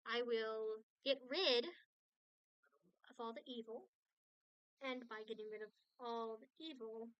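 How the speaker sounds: ordinary talk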